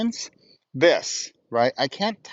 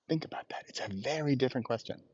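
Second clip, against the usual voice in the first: hushed; 13 dB quieter than usual. Real, not acted. Hushed reflective aside.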